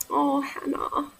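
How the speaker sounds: slow, lightly voiced pity; pitying coworker. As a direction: imitate a sympathetic colleague, drawn out. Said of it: "do the pity face".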